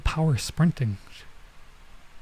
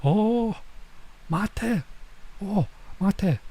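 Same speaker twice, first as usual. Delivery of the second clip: stunned oh; 8 semitones higher, 4 dB quieter, much slower than usual. Retold or imitated. Reacting to being leapfrogged.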